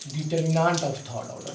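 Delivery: Determinant of third order said slowly in one breath; name the term slowly for the class; slow clear term. Shown as a demonstration. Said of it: "तो इसको क्या बोलते हैं बाबू?". (धीमे, साफ़ बोला गया शब्द)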